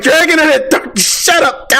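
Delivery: high excited battle yell; battle cry. Retold or imitated. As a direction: roar the attack name gleefully. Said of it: "I was like".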